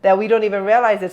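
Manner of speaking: ordinary delivery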